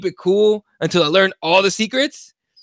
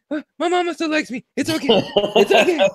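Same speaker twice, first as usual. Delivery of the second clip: rock and reassure yourself, a bit pitiful; hammed-up soothing to himself in a corner; self-soothing whimper; 7 semitones higher than usual.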